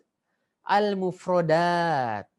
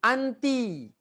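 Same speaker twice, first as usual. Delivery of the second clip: model word reading; 6 semitones higher than usual. Shown as a demonstration.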